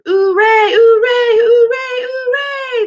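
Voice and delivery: high, loud drawn-out hoorays; ringing chant of hooray; cheering crowd. A narrator's reading. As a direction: cheer like a rowdy banquet crowd. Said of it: "the noise broke out again".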